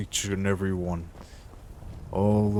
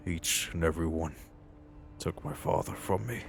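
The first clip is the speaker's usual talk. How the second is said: softer, heavy-hearted, with long pauses; somber remembrance; 2 semitones lower, 8 dB quieter than usual. Acted. Recalls father killed by bandits.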